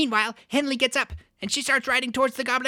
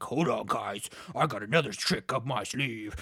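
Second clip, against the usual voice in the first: low, cool hero with a plan; confident hero voice; 11 semitones lower, 6 dB quieter than usual. Retold or imitated. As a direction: drop low and play the assured leader.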